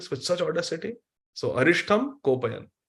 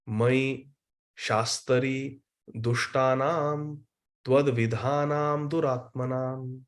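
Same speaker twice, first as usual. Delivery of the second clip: verse recitation; slower than usual. Shown as a demonstration. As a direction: intone the verse slowly, calling out at the start. Recites Krishna's Sanskrit verse.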